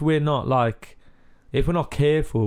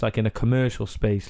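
usual speaking voice

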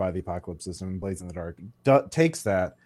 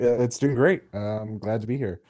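normal talking voice

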